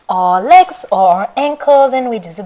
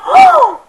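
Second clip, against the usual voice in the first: startled gasp; 7 dB louder than usual. Acted. Goldilocks wakes in fright.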